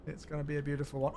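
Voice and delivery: usual speaking voice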